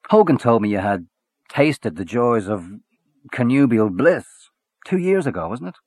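lightly voiced swaggering Irish journalist; a hint of a hearty Gallagher. A narrator's reading.

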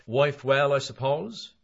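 a chatty, prying lift in the voice; changed voice. Acted. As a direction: switch to a brighter, put-on tone to change the subject. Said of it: "McCoy's changed voice said".

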